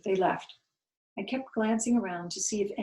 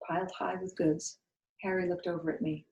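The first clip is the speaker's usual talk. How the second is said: plain reading voice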